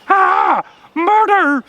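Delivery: high, loud terrified scream; screaming in terror. A narrator's reading. Rabbit attacked by a shape with a sword, screaming in terror. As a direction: shriek for your life. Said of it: "he screamed in terror".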